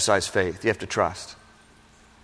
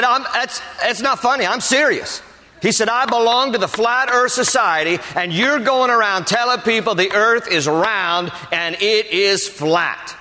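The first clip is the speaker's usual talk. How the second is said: much higher, insistent mock voice; imitating the flat-earther; 10 semitones higher than usual. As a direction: play the stubborn flat-earther, dead serious.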